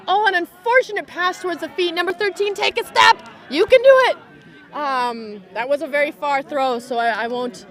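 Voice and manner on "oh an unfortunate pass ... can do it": high, rising shout of encouragement; urgent encouragement. Real, not acted. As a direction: start high, build to a loud cheer. Urging on a player after mistake.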